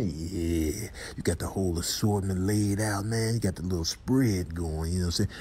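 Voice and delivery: low, soft snack admirer; quiet, low sly sweet-talk; smooth-talking character. Pretend. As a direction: murmur it low and easy.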